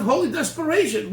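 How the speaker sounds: ordinary talk, nothing marked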